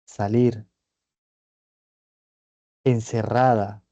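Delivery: isolated, weighed words; key words set apart. Shown as a demonstration. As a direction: lay out the words one by one like evidence. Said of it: "allá hay un significante".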